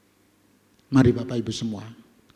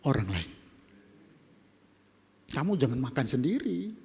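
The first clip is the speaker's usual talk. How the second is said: ordinary talk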